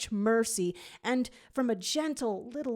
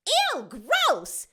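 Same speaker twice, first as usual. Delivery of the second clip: much higher, louder disgust; 11 semitones higher, 7 dB louder than usual. A narrator's reading. Jade sees the swarm, loud high disgust. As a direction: recoil with an eww. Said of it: "groans Jade".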